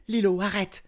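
high, loud cry to stop him; a panicked princess pleading. A narrator's reading. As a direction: shout it in alarm. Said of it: "s'écria Élastique".

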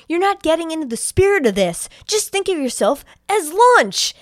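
high, loud theatrical taunting; exaggerated villain taunt. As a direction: act out Charles scolding his victim, swelling on the last word. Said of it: "that's how he fucking says it".

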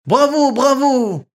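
high, loud, slow bravo; crowd cheer. A narrator's reading. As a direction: cheer like an audience. Crowd applauds.

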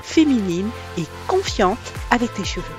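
bright announcer welcome over music; podcast intro read. Acted. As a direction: introduce the show like a radio host.

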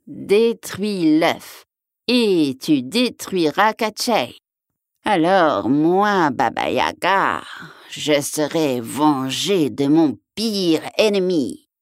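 slow, drawn-out witch voice; vengeful witch. A narrator's reading. Witch sending the hero to destroy Katchei, slow and vengeful. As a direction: stretch each word like a plotting witch.

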